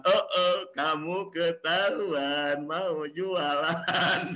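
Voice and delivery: slow mocking sing-song, oh oh you're caught; slow teasing. Teasing a friend caught trying to sell, slow and mocking. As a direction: drawl it out like catching someone red-handed.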